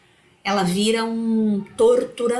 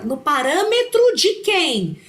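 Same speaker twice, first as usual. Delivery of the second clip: challenging question; 8 semitones higher, 3 dB louder than usual. Retold or imitated. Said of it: "E eu falo".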